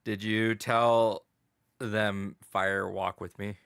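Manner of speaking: slow, drawn-out Twin Peaks line; slow ominous quote. Acted. Friend teasing host about the fire, slow ominous quote. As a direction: stretch each word slowly, ominously. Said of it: "quoting Twin Peaks line".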